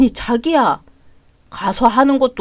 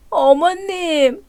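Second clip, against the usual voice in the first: slow, tearful whimper of a name; sick daughter-in-law crying to her mother-in-law; tearful call; 4 semitones higher, 4 dB quieter, much slower than usual. Acted. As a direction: call her softly through tears.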